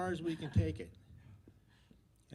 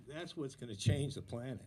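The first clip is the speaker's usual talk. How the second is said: ordinary delivery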